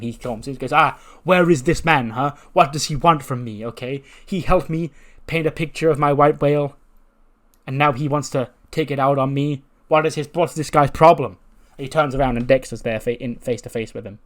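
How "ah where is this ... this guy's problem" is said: loud, raised, worked-up wrestler quote; exasperated rant. Retold or imitated. Wrestler ranting about the man following him, exasperated. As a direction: act out a fed-up tirade.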